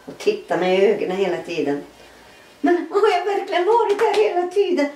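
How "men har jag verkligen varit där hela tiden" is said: high amazed rush; astonished question. Retold or imitated. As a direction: burst out in disbelief. Waking up astonished.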